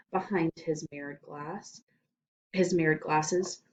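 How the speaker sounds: plain reading voice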